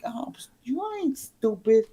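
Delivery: high, scornful opening easing into indignant rant; retold anger. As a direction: act out her sarcastic accusation. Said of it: "when I tell you she was pissed".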